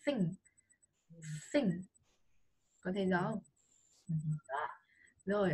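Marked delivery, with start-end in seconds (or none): 0.1-1.7 "Think" with a puff of air on th; short "Think", th all breath; airy th. Shown as a demonstration. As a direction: let the air reach a hand at the mouth on "Think". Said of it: "phải có âm gió".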